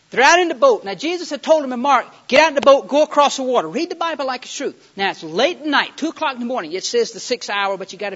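loud, high-pitched sermon storytelling; full-force preaching. Real, not acted. Preacher telling of disciples sent out by boat, full force. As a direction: belt out the Bible story in punchy phrases.

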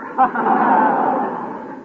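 loud high hearty fake laugh; big forced laugh. Shown as a demonstration. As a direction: lead the room in laughing.

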